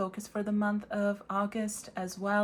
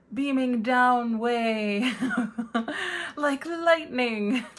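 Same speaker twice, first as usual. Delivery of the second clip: slow, laughing, higher and louder; 4 semitones higher, 6 dB louder, much slower than usual. Real, not acted. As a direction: laugh through the words. Laughing at own phrase.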